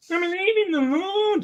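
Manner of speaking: mock voice of a man with a speech impediment. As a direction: squeak it out like the guy who talks funny. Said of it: "He goes".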